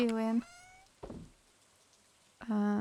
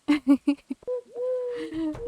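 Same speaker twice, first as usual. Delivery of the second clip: high laugh; 13 semitones higher, 5 dB louder than usual. Real, not acted. Streamer laughing at chat.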